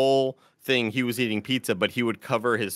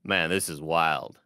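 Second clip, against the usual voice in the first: Paris impression; 5 semitones lower, slower than usual. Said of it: "Paris goes".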